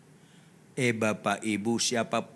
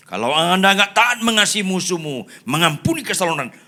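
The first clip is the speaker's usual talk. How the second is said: raised, high pulpit voice; forceful preaching; 9 semitones higher, 9 dB louder than usual. Real, not acted. Preacher warning the congregation, forceful. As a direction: preach it loud and insistent.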